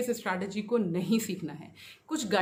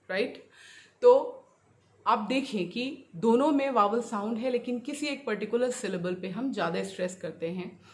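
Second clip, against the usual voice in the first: ordinary talk, nothing marked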